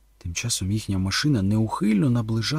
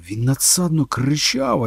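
ordinary delivery

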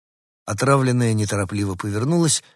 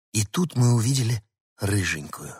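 ordinary delivery, nothing marked

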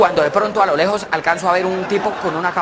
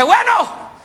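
high, irritated challenge; exasperated; 13 semitones higher than usual. Retold or imitated. Driver confronting yet another hitchhiker, exasperated. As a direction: demand it, fed up. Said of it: "Ese sí me sacó la piedra".

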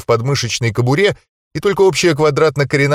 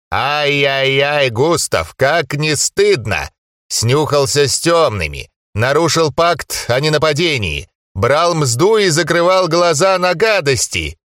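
put-on scolding old ghost; 3 semitones higher than usual. Ghost grandfather scolds grandson.

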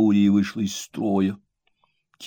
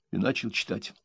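usual speaking voice

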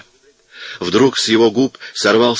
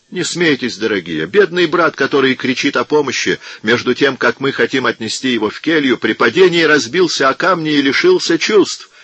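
higher, humble pleading tone; meek voice; 7 semitones higher than usual. A narrator's reading. Monk meekly tries to calm the hostile crowd.

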